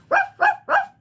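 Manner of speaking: high, loud yappy barking; puppy bark. A narrator's reading. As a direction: bark like a playful pup. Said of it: "barked the mischievous puffy".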